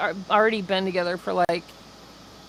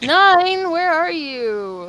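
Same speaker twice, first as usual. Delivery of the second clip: calling out; 8 semitones higher, 6 dB louder, much slower than usual. Acted. Calling out for Nine.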